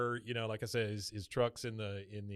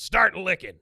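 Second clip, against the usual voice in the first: mock order; 6 semitones higher, 11 dB louder, slower than usual. Hank voiced ordering Bobby.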